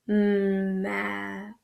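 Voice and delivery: slow, soft "Map." for sounding out; "Map." stretched to hear the ah; stretched word. Shown as a demonstration. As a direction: draw out "Map." so the vowel sounds. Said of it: "What about the second sound?".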